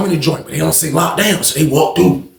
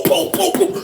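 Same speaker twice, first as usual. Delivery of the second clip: punch noises; slower than usual. Retold or imitated. Imitating punches.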